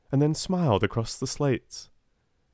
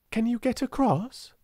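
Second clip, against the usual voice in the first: a lightly voiced girl, asking much higher; inquiring; 13 semitones higher than usual. A narrator's reading. Sarah asking the servant if he can cross. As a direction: ask it kindly across the roofs. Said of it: "she inquired".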